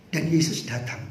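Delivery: normal talking voice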